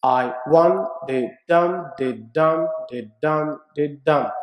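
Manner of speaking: da DUM da DUM, stress on each dum; iambic beat. Shown as a demonstration. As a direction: tap out the iambic rhythm. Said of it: "We call this iambic".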